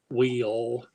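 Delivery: Will drawled in a Texan accent, stretched. Shown as a demonstration. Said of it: "So you can talk Texan".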